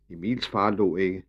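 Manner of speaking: plain reading voice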